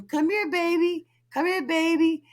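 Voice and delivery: gentle slow call to a pet; coaxing. Retold or imitated. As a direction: sweet-talk a dog to come back. Coaxing dog to come.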